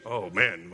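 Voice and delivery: deep, soft mock groan of complaint; low mock groan. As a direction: sink the voice low in a fake groan.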